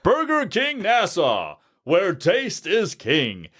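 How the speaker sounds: big ad slogan, every word stretched; Burger King announcer. Shown as a demonstration. Voice actor showing his ten-year ad job, announcer voice. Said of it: "I've been the voice of".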